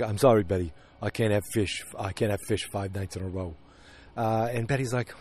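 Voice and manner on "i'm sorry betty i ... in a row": low-key, softened husband impression; a lightly voiced, subdued husband; Henry Francis. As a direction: play an apologetic husband, trailing quieter. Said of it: "This is my best Henry Francis yet".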